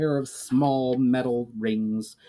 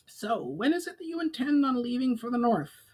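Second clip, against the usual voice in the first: higher, pinched goblin speaking gratefully; higher goblin voice, earnest; 12 semitones higher, 4 dB quieter than usual. A narrator's reading. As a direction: pitch it up like a goblin emissary offering help. Goblin asks departure.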